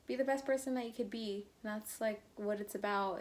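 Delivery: ordinary talk, nothing marked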